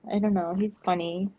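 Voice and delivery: usual speaking voice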